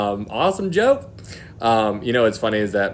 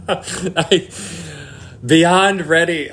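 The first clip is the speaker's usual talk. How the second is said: eager; 8 semitones higher, 5 dB louder, much slower than usual. Real, not acted. Longing for the rapture, emphatic.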